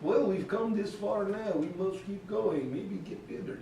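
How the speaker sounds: soft mock-Russian resignation; Russian accent. Migrant urging the others onward, Russian accent. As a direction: answer quietly in a put-on Russian accent. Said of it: "losing their Russian accent".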